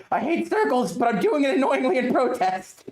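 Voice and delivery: high, annoying cartoon voice; imitating a random high-pitched character. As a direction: squeal it high in whiny protest. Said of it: "doing a random high-pitched voice".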